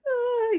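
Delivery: very high frightened whimper. Retold or imitated. Injured Toffler cornered, high whimper. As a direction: squeak out a scared cry. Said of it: "Toffler's like".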